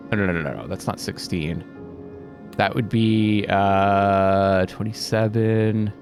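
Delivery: ordinary talk, nothing marked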